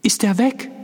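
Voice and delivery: high, loud fearful ask; woman asking if the ghost is gone; anxious question. A narrator's reading. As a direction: ask it trembling. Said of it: "fragte sie ängstlich".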